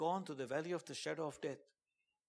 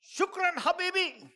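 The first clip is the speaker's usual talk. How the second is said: much higher, loud Arabic thanks; shout; 11 dB louder, slower than usual. Retold or imitated. The confused commander shouting thanks, high and loud. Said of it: "then he shouted".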